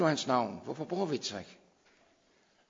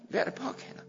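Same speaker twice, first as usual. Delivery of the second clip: whisper; 12 semitones higher, 4 dB quieter, faster than usual. Acted. Preacher hushes key phrase.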